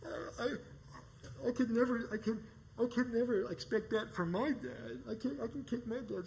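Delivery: high, whimpering, stammering voice; whiny beaten guy. Retold or imitated. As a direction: talk like a beaten bully near tears. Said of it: "the guy's like".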